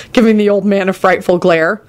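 ordinary delivery, nothing marked

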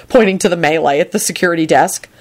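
plain reading voice

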